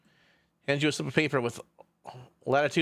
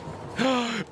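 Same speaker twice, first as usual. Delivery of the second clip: high intake of breath; excited gasp; 7 semitones higher than usual. Real, not acted. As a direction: gasp with delight before cheering. Excited at beach episode.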